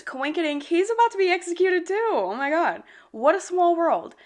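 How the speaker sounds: mock the lazy plot twist; sarcastic mock surprise. Real, not acted. Mocking the convenient coincidence.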